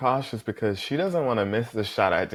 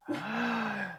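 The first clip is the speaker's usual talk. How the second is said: sharp high gasp of surprise; quick breathless gasp of delight; excited gasp; 12 semitones higher, 7 dB quieter than usual. Real, not acted. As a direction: gasp as if the guest just walked in. Guests revealed.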